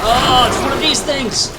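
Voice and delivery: startled loud cry; alarmed shout. Real, not acted. Monster appears, alarmed.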